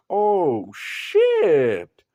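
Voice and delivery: high, loud sarcastic yell of a villain; loud, high gloating villain; mocking shout. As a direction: shout the fake surprise way up high. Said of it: "he's like".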